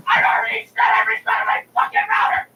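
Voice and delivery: high-pitched angry shout; exasperated yell. Retold or imitated. Caller asked to restart the router, exasperated yell. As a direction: explode at being asked twice. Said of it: "but I wanted to say it".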